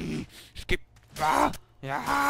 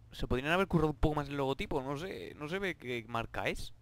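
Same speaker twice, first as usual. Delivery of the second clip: ordinary delivery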